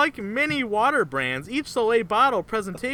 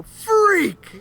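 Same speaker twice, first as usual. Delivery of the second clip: sudden high shouted Freak; grandpa yelling Freak; 12 semitones higher, 7 dB louder than usual. Retold or imitated. Retelling the grandpa's outburst at the applicant, a high mock yell. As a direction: bark it out like a cranky old grandpa. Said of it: "you just hear the grandpa go".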